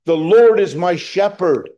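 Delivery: loud, high cry of faith. Pretend. Preacher voicing a needy man crying out, loud and high. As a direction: cry it out to the whole congregation. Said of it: "cry out".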